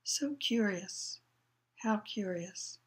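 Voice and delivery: hushed, absorbed murmur; muttering. A narrator's reading. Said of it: "still muttering".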